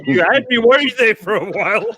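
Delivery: talking through real laughter; laughing. Real, not acted. Host to the joke teller after the punchline, laughing. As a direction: say it while still cracking up.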